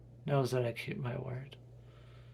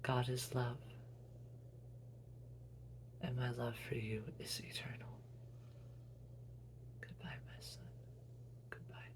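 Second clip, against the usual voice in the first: softer dying goodbye; fading whisper; 13 dB quieter than usual. Acted. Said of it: "And she says".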